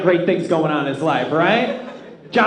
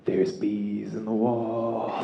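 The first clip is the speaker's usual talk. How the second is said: low, slow, hushed creepy murmur; eerie low murmur; 8 semitones lower, 11 dB quieter, much slower than usual. Retold or imitated. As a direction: say it like an unsettling secret. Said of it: "he goes".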